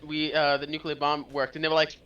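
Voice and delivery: ordinary talk, nothing marked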